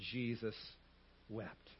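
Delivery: low, soft, each word set apart; solemn, hushed. Real, not acted. Preacher reading the shortest verse, solemn and hushed. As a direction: let each word sink in quietly.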